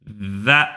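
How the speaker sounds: za said low and heavy; "Za." with tongue tip, low emphatic za. Shown as a demonstration. Teacher demonstrating emphatic za, low and heavy. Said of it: "Le bout de la langue".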